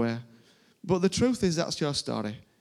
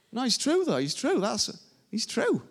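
insisting higher, it's true; earnest high insistence; 10 semitones higher than usual. Real, not acted.

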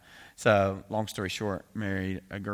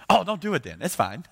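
breezy, much higher mock voice of the laid-back pastor; easygoing pastor; 10 semitones higher, 8 dB louder than usual. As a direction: toss it off like it's no big deal, pitched high. Pastor Marty shrugs off calling.

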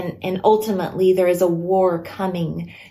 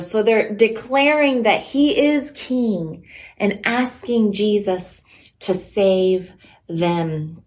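ordinary talk, nothing marked

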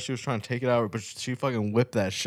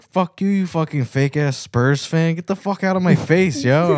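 mock drunk yell; 7 semitones higher, 7 dB louder than usual. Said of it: "he was like".

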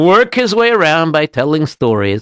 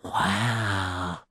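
soft, low, drawn-out wonder; hushed awe; 5 semitones lower, 12 dB quieter than usual. Acted. Rabbi looks past the opening gate, hushed awe. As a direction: let it out softly, amazed.

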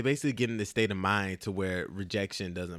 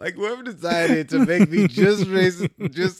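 laughing; 7 semitones higher, 9 dB louder than usual. Real, not acted. Still cracking up at joke.

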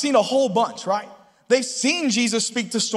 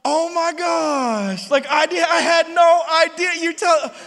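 excited, higher, gushing gratitude; imagined gushing thanks; 6 semitones higher, 4 dB louder than usual. Retold or imitated. Imagined wife gushing her thanks. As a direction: gush with surprised thanks. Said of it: "I thought she was gonna be like".

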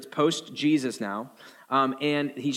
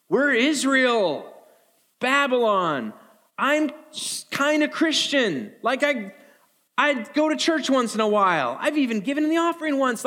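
much higher, self-satisfied mock plea; smug lukewarm believer; 10 semitones higher, 4 dB louder than usual. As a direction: puff up like a casual churchgoer demanding favor. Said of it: "That's us saying".

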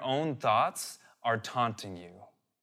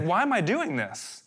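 higher-pitched, insistent self-questioning; exasperated string of whys aimed at yourself; questioning himself; 6 semitones higher than usual. Acted. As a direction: press each why, acting out self-doubt. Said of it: "now he's questioning himself".

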